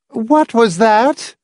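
high, drawn-out matronly query; Missus Pritchett. A narrator's reading. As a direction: ask in a slow, high woman's voice. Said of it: "Missus Pritchett asked".

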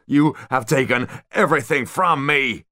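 loud, high, anguished shout. A narrator's reading. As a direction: shout it with wounded rage. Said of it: "he shouted".

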